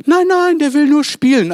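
high, loud mocking call of a dog owner. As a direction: shout it like a clueless owner calling after you. Parodierter Hundebesitzer ruft. (laut und hoch nachgeäffter Hundebesitzer)

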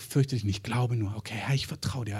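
calming himself down in the dark; quiet self-reassurance. Retold or imitated. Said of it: "der muss immer wieder".

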